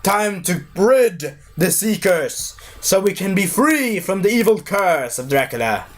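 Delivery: high, slow preacher rallying a mob; priest's call. Retold or imitated. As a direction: rouse the crowd like a fanatic priest. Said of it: "the priest saying".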